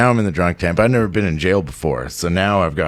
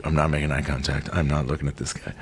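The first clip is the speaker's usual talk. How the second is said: much softer, low and fast; scared under-the-breath thought; 6 semitones lower, 9 dB quieter, faster than usual. Retold or imitated. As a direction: keep your head down and barely voice it. Scared inner voice, avoiding him.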